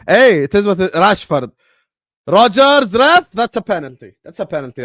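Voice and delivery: high, loud yell at the game; shouted match reaction. Real, not acted. Football fan watching live claims a penalty, shouting. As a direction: shout urgently at the referee.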